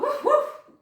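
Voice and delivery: high, loud woof woof; barking dog. A narrator's reading. Narrator barks as the dog. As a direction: bark like an eager dog.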